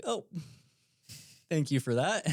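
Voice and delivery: mock-grateful, laughing through it; laughing sarcastic thanks. Thanking the guide for the grim snake answer, laughing. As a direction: laugh it off with a wry thank you.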